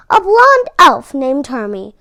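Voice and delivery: plain reading voice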